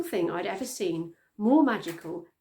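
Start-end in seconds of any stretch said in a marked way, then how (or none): none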